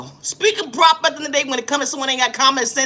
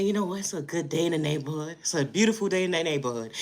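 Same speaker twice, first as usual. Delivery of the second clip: dreamy soft voice; 6 semitones lower, 8 dB quieter than usual. Pretend. Mock cheerful self on walk.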